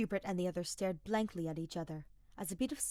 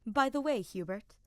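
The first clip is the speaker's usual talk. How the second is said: higher, louder opening then easing; playful regal tone; light teasing lady; 5 semitones higher, 7 dB louder than usual. Acted. Edelgard voiced in story.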